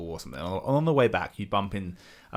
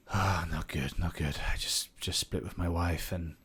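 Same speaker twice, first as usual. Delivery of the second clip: dejected friend; 5 dB quieter than usual. Retold or imitated. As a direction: act out a mate glumly unloading his troubles. Acting out a friend's sad split.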